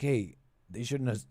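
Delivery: ordinary delivery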